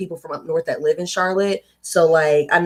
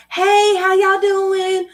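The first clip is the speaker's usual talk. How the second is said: much higher, slow, drawn-out y'all greeting; Southern greeting; 13 semitones higher, much slower than usual. Retold or imitated. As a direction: stretch out a syrupy Southern hello. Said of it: "People look at us crazy when we go other places".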